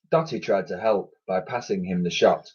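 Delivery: ordinary delivery, nothing marked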